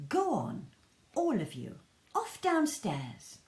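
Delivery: high, bossy shooing voice; shooing mum. Acted. Mum shooing the children downstairs, high and bossy. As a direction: send the children off, exasperated.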